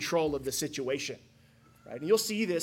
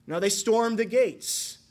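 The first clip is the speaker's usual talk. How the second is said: ordinary talk, nothing marked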